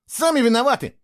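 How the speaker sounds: high, defiant cry; shouting back. A narrator's reading. Boy shouting back at his accusers, defiant. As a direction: yell back defensively. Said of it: "в ответ прокричал Фло".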